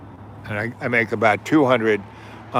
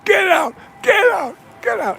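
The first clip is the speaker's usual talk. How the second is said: shrieking, much higher mock yell; mock furious shout; 4 dB louder than usual. Teacher jumping up and down ordering him out, shrieking high. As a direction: shriek like a teacher throwing a kid out. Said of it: "he's jumping up and down".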